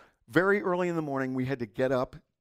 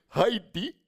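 imitated Indian accent, higher with a rising end; 5 semitones higher, 4 dB louder than usual. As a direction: repeat the question in a mock Indian accent. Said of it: "he has an accent".

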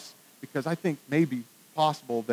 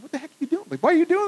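annoyed passenger; 11 semitones higher, faster than usual. Retold or imitated. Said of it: "And this guy's like".

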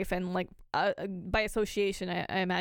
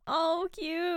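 cooing; 9 semitones higher than usual. Real, not acted. Cooing over the dog.

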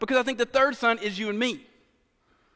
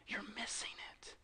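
hushed, whispered repeat for weight; whispered plea; 21 dB quieter than usual. Acted. Preacher voicing Jesus to the Pharisees, whispered plea. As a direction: drop right down to a whisper.

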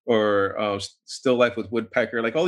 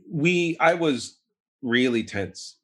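usual speaking voice

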